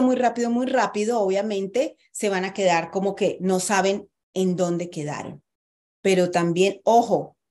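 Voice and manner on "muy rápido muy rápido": talking too fast. Shown as a demonstration. Voice coach showing students rushed speech, fast. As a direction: rattle it off quickly, running the words together. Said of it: "Si hablas muy rápido".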